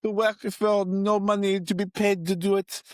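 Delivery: high, excited French chef voice. Acted. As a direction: gush like a delighted French chef. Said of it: "he's like".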